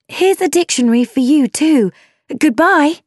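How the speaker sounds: high, hurried-sounding farewell; eager calling out. A narrator's reading. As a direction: call up brightly to someone departing. Jemima calls to leaving carriage.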